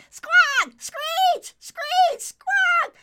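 shrieking, very high bird screams; owl screaming. Acted. The burning owl screams as Merlin whacks it. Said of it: "the owl kept screaming".

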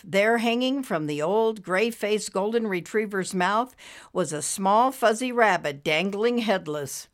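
plain reading voice

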